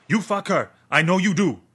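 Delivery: high, loud shout, You fuck her falling hard on you; furious accusation. Acted. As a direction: hurl the accusation down. Gunman accuses Dan.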